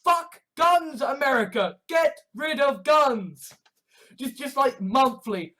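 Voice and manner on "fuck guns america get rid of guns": high, forceful sloganeering; shouted plea. Real, not acted. Shouted anti-gun slogans to America.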